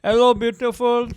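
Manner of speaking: flirt in a put-on Indian accent; mock Indian accent. Comic voicing men's messages to women, mock Indian accent.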